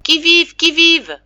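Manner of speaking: high, loud challenge into the fog; shouted call. A narrator's reading. Father groping toward family, calling loudly. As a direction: call out blindly through the dark. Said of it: "en criant".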